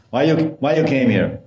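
a touch of official interrogation; policeman. Acted. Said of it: "starts speaking like a policeman".

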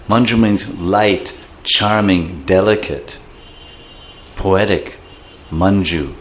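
usual speaking voice

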